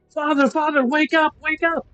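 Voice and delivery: high, urgent acted cry; Irina yelling out. Game master as Irina waking her father, high urgent yell. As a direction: call high over a din to rouse someone. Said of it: "yelling out over the din".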